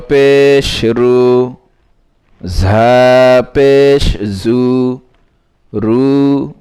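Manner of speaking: measured, chanting recital of spelled syllables; recitation drill. Shown as a demonstration. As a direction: read each syllable out slowly like a teacher drilling a class. (ধীরে ধীরে সুর করে বানান পড়া)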